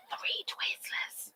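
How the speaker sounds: breathy whispered repeat of the count. Real, not acted. Whispering the sweet count to the chat. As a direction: whisper it as a secret.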